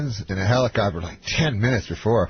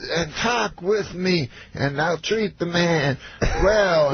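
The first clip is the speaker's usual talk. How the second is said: slow, high, stretched impression; Bill Cosby; 6 semitones higher, slower than usual. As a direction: drawl a grand promise in mimicry. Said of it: "He says".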